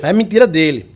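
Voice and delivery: usual speaking voice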